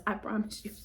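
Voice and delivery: normal talking voice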